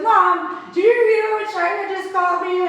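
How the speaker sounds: put-on high, indignant kid complaint; tattling sister. Sister telling mom about the insult, mock indignant.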